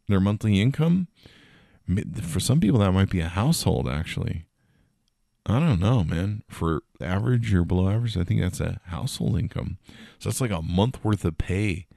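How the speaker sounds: usual speaking voice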